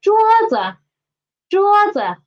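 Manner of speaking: teacher's model 桌子, 子 falling below a high 桌. Shown as a demonstration. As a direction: say 桌子 high first, then drop light 子. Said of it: "Read after me".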